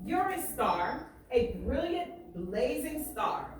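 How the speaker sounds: lively read-aloud, painting each picture; story reading. Acted. Teacher reading a barbershop story aloud to students, lively. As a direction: read it to the class with swagger and pride. Reads the book aloud to class.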